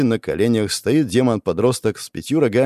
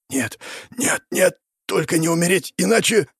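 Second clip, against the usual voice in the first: weak plea climbing higher; desperate panic; 8 semitones higher than usual. Acted. Hero losing consciousness, weak rising panic. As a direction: fight off death with failing breath. Hero blacking out, fears death.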